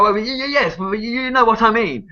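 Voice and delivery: high, stammering imitation of a corrected woman; mock her fluster, voice pitched right up; flustered barrister, mocked. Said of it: "And she was like".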